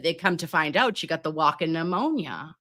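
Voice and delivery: normal talking voice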